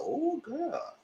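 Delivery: put-on high, soft sighing "Oh, girl"; mock swoon. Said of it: "And I said".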